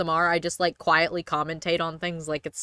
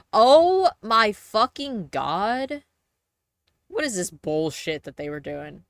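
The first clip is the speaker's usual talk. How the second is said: exasperated; 2 semitones higher, 4 dB louder, slower than usual. Real, not acted. Frustrated outburst at game.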